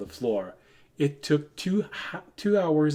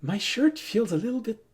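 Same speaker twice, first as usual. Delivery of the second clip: elf voice pitched higher, coy and teasing; flirty elf voice; 9 semitones higher than usual. Retold or imitated. Elf teasing the party with a coy, higher voice. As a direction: play the elf, coyly loosening her shirt. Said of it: "you hear the elf go".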